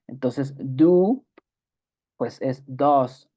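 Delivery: "do" said du, "does" said dos, slow and clear; do versus does. Shown as a demonstration. Teacher contrasting do and does pronunciation, slow. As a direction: stretch do, then does with a short vowel and s. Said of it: "Cambia la pronunciación".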